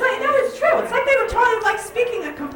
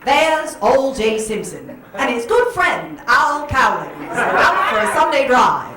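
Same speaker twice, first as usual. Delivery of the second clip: hammed-up old-time newsreel voice; 1940s newsreel announcer; 4 dB louder than usual. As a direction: sell a tragedy as a cheery outing. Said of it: "They'd be like".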